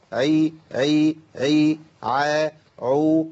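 تكرار صوت العين بضغط مبالغ; كرر "عي" بتكلف واضح; exaggerated ain. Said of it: "دي مبالغات". (تقليد مبالغة العين صوتًا منفردًا)

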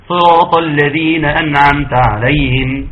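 slow melodic recitation; Quran recitation. Shown as a demonstration. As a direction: recite measured, with sustained vowels. Recites from the Fatiha. (ترتيل قرآني بطيء)

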